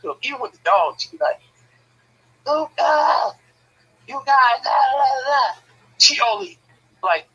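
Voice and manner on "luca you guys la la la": loud, high-pitched mock yell; imitated hollering. Sister yelling at the dog, imitated loud and high. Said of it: "she don't have no low voice".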